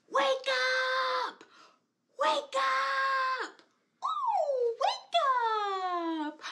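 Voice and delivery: high, loud wake-up shout; calling out. Shown as a demonstration. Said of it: "Let's say, "Wake up!".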